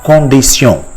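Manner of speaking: "condition" as French kon-dee-syon, nasal vowels; French pronunciation. Shown as a demonstration. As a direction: say "condition" the French way, ending in -syon, not -shun. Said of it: "how it is pronounced".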